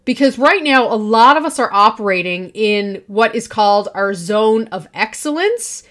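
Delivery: normal talking voice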